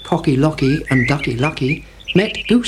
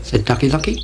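ordinary delivery

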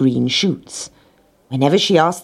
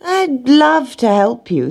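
high, drawn-out mock willingness; insincerely eager; 10 semitones higher, 3 dB louder, slower than usual. Rat declining to help hen, falsely eager. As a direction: gush slowly before making an excuse.

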